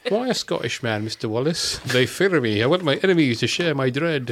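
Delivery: put-on Scottish accent; lightly voiced Sean Connery; Sean Connery impression. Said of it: "That was Sean Connery".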